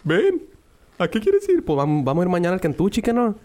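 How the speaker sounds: mocking high-pitched impression of a friend; squeaky teasing voice, way up high; voz aguda burlona. Imitating Roger, high mocking voice. (voz chillona de burla)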